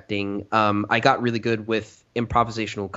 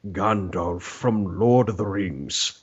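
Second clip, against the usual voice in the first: Gandalf voice; 4 semitones lower, much slower than usual. Shown as a demonstration. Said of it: "it would go into".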